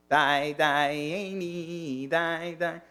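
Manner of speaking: put-on slow, sung Dayeni in a Galicianer accent, Dayeni said as "Die, Dayeni". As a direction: croon it slowly like a Galicianer great-grandfather at Pesach. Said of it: "'Cause of this Galicianer, uh, accent".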